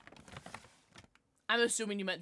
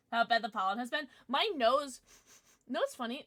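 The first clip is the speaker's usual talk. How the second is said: ordinary talk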